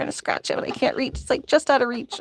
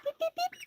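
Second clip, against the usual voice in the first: very high, soft beep noises; tiny high beeps to coax a hen; cutesy beeps; 9 dB quieter than usual. Real, not acted. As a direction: squeak out beeps to a chicken.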